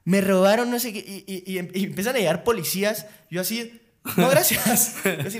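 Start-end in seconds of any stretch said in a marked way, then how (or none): 0.0-1.0 high, louder mock grievance; imitated agitated woman. Robbed señora recording a story in the mall, high and agitated. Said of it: "a grabar una historia". 4.2-5.0 high, loud, laughing refusal; mock-polite brush-off pitched up; retold refusal. Turning down an agitated stranger, high and half-laughing. Acts his refusal to the woman.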